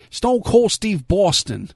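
put-on Boston accent.

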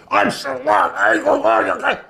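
high, drawn-out put-on rant voice; hammed-up tantrum; mock anger. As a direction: imitate a raging radio host, stretched out and high. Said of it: "they're screaming and they're angry".

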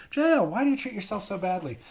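high, pleading put-on voice; mock concerned questioner. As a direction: imitate someone fretting over a friend.

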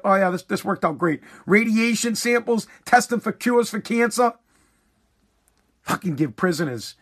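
ordinary talk, nothing marked

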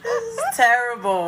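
high, laughing groan. Real, not acted. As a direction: laugh through the words. Laughing at herself.

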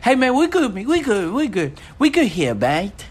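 imitated Kiwi or Australian accent, starting high and falling; New Zealand accent. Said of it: "like New Zealand or like Australian".

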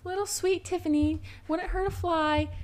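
high-pitched little coo; mock cutesy. Cooing about sweet Tiffany who wouldn't hurt a fly, mock cutesy.

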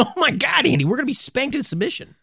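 high, fast, delighted squeal; excited squeal. Real, not acted. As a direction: gush with giddy excitement. Excited reaction to letter.

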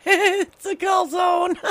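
laughing it out high; shrieking through laughter. Real, not acted. Cracking up at the story.

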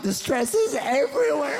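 high-pitched stressed-out retort; exasperated comeback. Acted. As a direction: let the pitch jump up in comic panic. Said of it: "I'm like".

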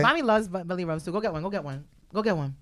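soft, cooing to the dog; talking to dog. Real, not acted. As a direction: coax the dog gently. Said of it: "talking to her dog".

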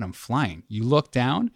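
ordinary talk

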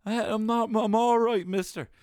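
high, loud confused insisting; dazed pleading lad. Retold or imitated. Hallucinating lad telling the teacher he's fine, high and loud. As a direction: insist you're fine while totally out of it. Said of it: "to think".

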